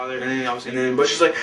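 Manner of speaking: ordinary talk, nothing marked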